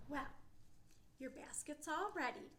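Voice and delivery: ordinary delivery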